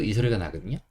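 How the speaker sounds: usual speaking voice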